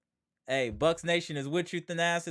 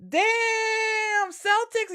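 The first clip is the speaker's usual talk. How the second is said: gloating taunt; much slower than usual. Real, not acted. Streamer jeering at the Celtics, high gloating shout. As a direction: crow over the beaten team.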